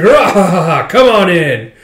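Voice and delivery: loud, high guffawing welcome; boisterous master. Acted. Master welcomes them to the show, boisterous guffaw. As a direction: laugh big, then draw out the invitation.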